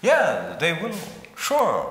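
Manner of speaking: usual speaking voice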